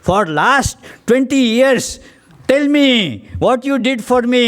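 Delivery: high-pitched, mildly indignant mock scolding; miffed wife. Piqued wife answers husband's "I love you", high mock scolding. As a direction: scold him like a fed-up wife. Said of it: "miffed for something".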